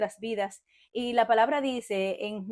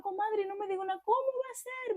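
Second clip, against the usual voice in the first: much higher, astonished disbelief; 13 semitones higher than usual. Retold or imitated. Sister on the phone, astonished at the news, much higher disbelief. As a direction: gasp it out high, astonished at the news. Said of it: "me dice, muy asombrada".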